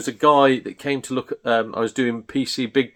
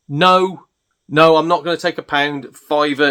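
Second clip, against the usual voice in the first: louder, higher, acted-out refusal; exasperated refusal; 4 semitones higher, 5 dB louder than usual. Seller turning down a haggler's lowball offer, exasperated. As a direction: retell a firm, fed-up refusal.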